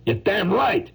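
high, indignant insistence; worked-up retort. Acted. Painter insisting on his own talent, high worked-up retort.

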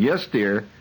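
dutiful yes-dear spouse, increasingly high and slow; henpecked marriage counselor. Acted. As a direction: meekly agree into the phone, pitch climbing. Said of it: "the marriage counselor kept say-, keeps saying".

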